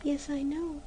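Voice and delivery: high, soft and sorrowful; sad. Acted. Girl sadly admitting to January. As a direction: sigh it out sadly. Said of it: "said Maruschka sadly".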